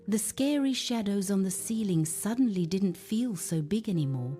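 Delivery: ordinary delivery, nothing marked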